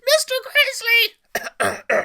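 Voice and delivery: very high, loud squeak; teen squeaking out the name; squeaky shout. Acted. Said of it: "Barnett squeaked".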